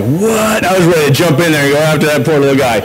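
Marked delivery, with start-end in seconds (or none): 0.0-0.6 shocked what. Retold or imitated. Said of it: "But I was like".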